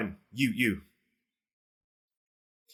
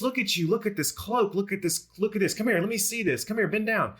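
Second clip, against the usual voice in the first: excited Kazlan voice; 12 semitones higher than usual. Acted. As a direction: greet her like a long-lost pal, way up high.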